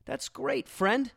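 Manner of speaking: high-pitched, hammed-up delight; mock-enthusiastic reply. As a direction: gush in a put-on high voice, plugging the show. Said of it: "You go".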